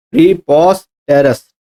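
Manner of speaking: प्रीपोस्टेरस slowly in separate chunks, pre-pos-terous; broken into syllables. Shown as a demonstration. Teacher breaking up प्रीपोस्टेरस to check its spelling, syllable by syllable. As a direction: say प्रीपोस्टेरस piece by piece, pausing between parts. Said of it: "दो तीन जगह से break कर सकते हो".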